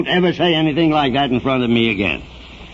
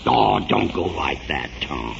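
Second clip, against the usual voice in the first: lower, softer plea trailing off; 10 semitones lower, 5 dB quieter than usual. Acted. Mayor softly begs Tom not to leave. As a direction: gently beg him to stay. Young man leaving upset.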